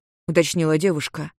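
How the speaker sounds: usual speaking voice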